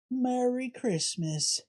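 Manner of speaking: soft, slow, hesitant Merry Christmas; timid greeting. Acted. Shopper meekly returning the greeting to the cashier, quietly. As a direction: mumble it almost under your breath. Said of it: "Say it louder".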